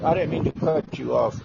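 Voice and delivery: ordinary talk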